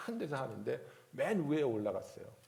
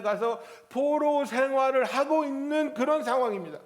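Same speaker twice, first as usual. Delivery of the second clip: loud, high, driving sermon; fiery preaching; 7 semitones higher, 9 dB louder than usual. Acted. Pastor pressing Israel's fall on the church, loud and high. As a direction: preach with full force.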